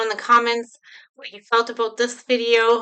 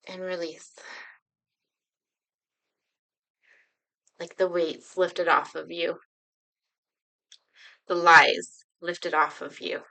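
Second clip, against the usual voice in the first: guided meditation. Acted. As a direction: ease them gently into the feeling. Leads a guided breathing exercise.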